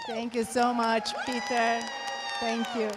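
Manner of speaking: loud, bright shout of thanks. Real, not acted. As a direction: call your thanks out across the hall. Shouting thanks across the room.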